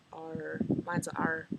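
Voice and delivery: "R" drawn out slowly with a strong pirate-like R; heavy R. Shown as a demonstration. Vlogger showing her own R, slow and heavy. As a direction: drag out "R" like a pirate. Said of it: "really put emphasis on my R's".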